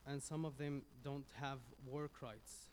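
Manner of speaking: ordinary talk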